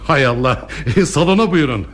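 high-pitched, flustered hospitality. Acted. Father showing an honest stranger into the salon, flustered. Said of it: "ne söyleyeceğimi şaşırdım açıkçası".

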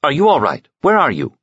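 a worried officer pressing for answers; clipped anxious worry; curt and anxious. Acted. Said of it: "curt and anxious".

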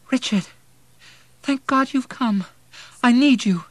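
high, loud, desperate relief; desperate woman. A narrator's reading. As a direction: cry out to a lost husband. Delirious mother calls dead husband.

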